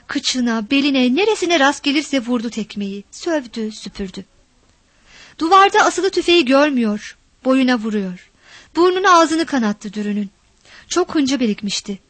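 ordinary delivery, nothing marked

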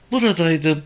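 put-on high girl's voice; young girl. A narrator's reading. Girl answers police questioning, high young voice.

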